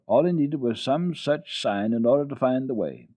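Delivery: usual speaking voice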